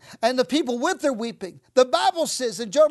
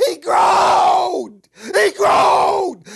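loud drawn-out cry of he groaned; preaching shout; 3 semitones higher, 8 dB louder, much slower than usual. Real, not acted. Preacher crying out to the church that he groaned. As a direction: shout it out, stretching the word.

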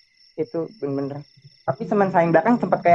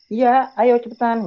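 higher, drawn-out hurry-up; retold urging; 7 semitones higher, slower than usual. Friend answers a doubting junior, retold urging. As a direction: act out impatient urging, stretching the words.

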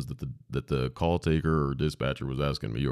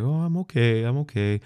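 calm mother; 8 semitones higher, 3 dB louder than usual. Retold or imitated. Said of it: "totally cool, totally calm".

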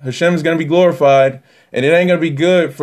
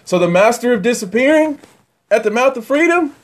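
much higher, carried-away preaching; excited yelling; 10 semitones higher than usual. Real, not acted. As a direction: get swept up in the revelation. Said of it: "I'm yelling. I'm sorry".

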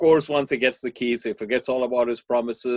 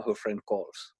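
ordinary talk, nothing marked

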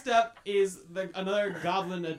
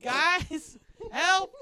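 high, loud call for help; panicked plea; 8 semitones higher, 6 dB louder, much slower than usual. Acted. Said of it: "he's like".

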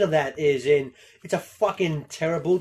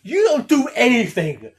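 hammed-up furious dad, higher and louder; angry father; 7 semitones higher, 7 dB louder than usual. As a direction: rant at the lazy son. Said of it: "Pierce Brosnan just be like".

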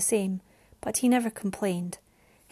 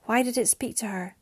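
plain reading voice

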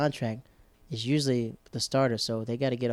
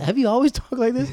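high, loud, laughing disbelief; retold disbelief; 11 semitones higher, 7 dB louder than usual. Asking friend about her new way of talking, incredulous. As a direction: question it in mock astonishment. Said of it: "I was like".

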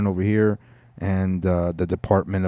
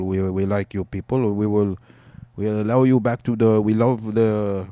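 put-on Arabic accent; 3 dB louder than usual. Palestinians welcoming newcomers, in a mock Arabic accent. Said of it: "I'll do an Arabic accent".

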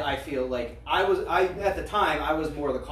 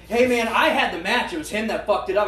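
retold excuse; 5 semitones higher, 5 dB louder than usual. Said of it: "had the audacity to stop me".

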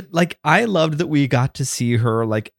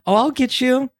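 put-on high playful kid voice; lightly voiced playful girl; playful young girl; 13 semitones higher than usual. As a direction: tease like a little girl. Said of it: "She's like".

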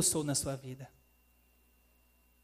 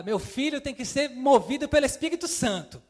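raised, louder mock of an older aunt's admonition; hammed-up auntie voice, higher and louder; imitating tia Estela; 8 semitones higher, 7 dB louder than usual. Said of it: "Tia Estela vai lá".

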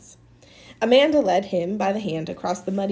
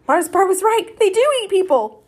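high, loud startled narration; alarmed; 11 semitones higher, 8 dB louder than usual. A narrator's reading. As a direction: blurt it out in alarm.

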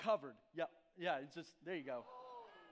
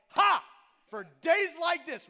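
big triumphant "Ha!", much louder and higher, then slow and proud; smug boast; 11 semitones higher, 16 dB louder, slower than usual. Retold or imitated. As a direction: crow it like you won the argument. Boasting to wife about boots.

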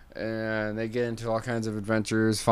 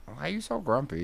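chirpy bird; 7 semitones higher, faster than usual. Acted. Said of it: "the bird's like".

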